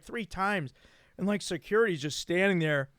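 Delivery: usual speaking voice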